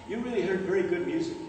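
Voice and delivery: ordinary delivery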